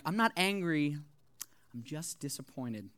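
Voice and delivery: imitated parent's letdown, dropping low and soft; disappointed parent. Parents upset with their child, imitated quiet disappointment. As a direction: sink into a quiet, let-down parental voice. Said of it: "upset with you".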